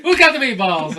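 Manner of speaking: high, loud hammed-up call; mock calling out. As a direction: call it across in playful excitement. Acting out calling for meatballs.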